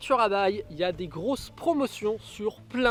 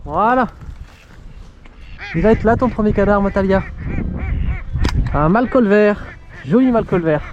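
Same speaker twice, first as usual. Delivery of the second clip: calling out; 13 dB louder than usual. Real, not acted. Hunter calling out to his dog.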